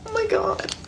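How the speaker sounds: high, soft exasperated sigh of disbelief; soft, high "Oh my God" after the story; disbelieving oh my God. Real, not acted. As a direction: breathe it out, amazed.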